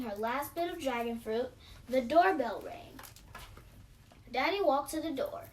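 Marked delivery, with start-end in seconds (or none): none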